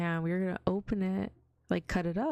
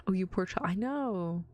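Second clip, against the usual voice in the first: usual speaking voice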